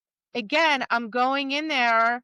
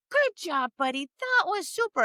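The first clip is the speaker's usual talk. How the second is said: high, bright praise for the dog; upbeat praise; 6 semitones higher than usual. Pretend. Handler praising her dog in the ring, high and happy. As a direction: lift the voice right up to reward the dog. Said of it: "I wanna be like".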